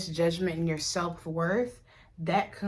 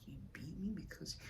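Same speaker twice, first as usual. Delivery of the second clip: breathy unvoiced whisper, trailing off; whispered disbelief; 15 dB quieter than usual. Questioning her father's words in her head, whispered. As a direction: whisper it in stunned confusion.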